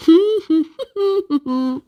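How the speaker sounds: happy high little giggle. Acted. Little Miss Giggles giggles arriving with drinks. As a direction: giggle to yourself.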